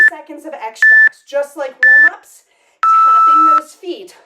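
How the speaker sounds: shouted announcement; loud call. Real, not acted. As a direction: announce it to the class. Coach announces final exercise.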